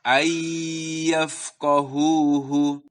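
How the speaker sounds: long held nasal y in ayyafqahuhu; gunnah on ayyafqahuhu. Shown as a demonstration. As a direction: recite ayyafqahuhu slowly, holding the merged sound. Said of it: "tapi ditahan".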